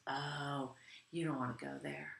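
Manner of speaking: low, very soft imitated caution; mock-ominous quiet stranger; hushed warning. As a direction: confide it like a secret warning. Stranger warning them off in story.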